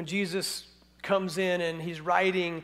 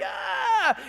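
high crowd cheer imitation; high mock yell; mock crowd shouting; 3 dB louder than usual. They're, they're shouting.

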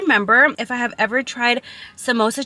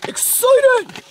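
even higher, squealing excitement; very excited; 13 semitones higher than usual. Real, not acted. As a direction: climb higher with glee.

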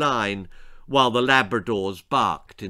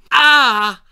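loud, high scream of pain; sudden scream; 12 semitones higher, 12 dB louder than usual. A narrator's reading. Scalded Spike feels hot tea, screaming suddenly. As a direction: burst out like a fire alarm. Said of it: "Spike's sudden scream".

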